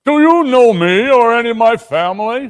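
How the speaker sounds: high, loud booming question; jolly giant ghost. Acted. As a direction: boom it out warmly.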